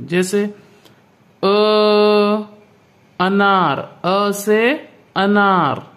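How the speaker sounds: अ said short and crisp, with अनार drawn out slowly; teacher showing the short vowel; short a sound. Shown as a demonstration. Teacher showing pupils the short अ, crisply. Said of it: "आपको उच्चारण करके पढ़ना चाहिए".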